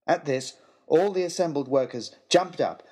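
plain reading voice

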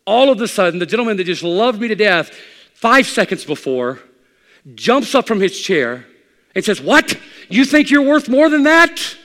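loud, high, slow outraged man; a church man jumping up in outrage at the pastor; indignant outburst. Retold or imitated. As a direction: blurt it out in offended disbelief. Angry church man jumps up.

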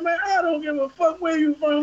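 high-pitched, indignant retold retort; defiant comeback. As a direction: wave him off, pitched well up.

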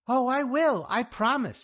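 high, loud, wounded promise; upset. A narrator's reading. Louise thinking the insult was for her, upset. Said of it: "said Louise, upset".

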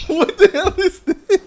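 high, loud, slow and laughing; mock bewildered shout; imitating a confused wrestler; baffled outburst. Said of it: "it's like".

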